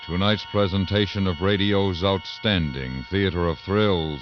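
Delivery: ordinary talk, nothing marked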